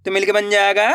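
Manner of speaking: first part slow and drawn out, then the whole word said plainly; patient teacher reading words for children; sound-out reading drill. Shown as a demonstration.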